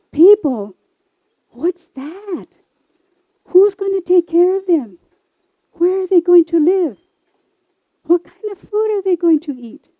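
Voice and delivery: loud, high, alarmed questions from the crowd; the animals cry out in worried alarm; alarmed outcry. Acted. Animals in the audience crying out in alarm at the idea.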